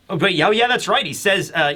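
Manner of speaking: ordinary delivery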